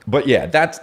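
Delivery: ordinary talk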